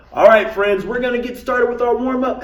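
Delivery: ordinary talk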